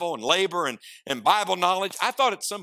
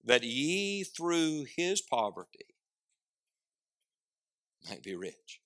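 very slow reading fading to softer; scripture reading; 4 dB quieter, much slower than usual. Shown as a demonstration. Preacher reading the verse end slowly, trailing off. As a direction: linger on each word, then let it die away. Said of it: "Look at the end of verse nine".

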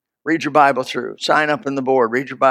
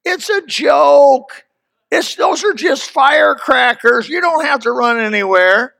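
dismissive bystanders; 8 semitones higher, slower than usual. Retold or imitated. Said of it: "people standing up saying".

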